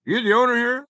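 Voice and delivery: imitating the stranger. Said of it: "he goes".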